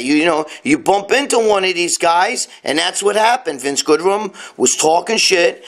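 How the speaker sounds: usual speaking voice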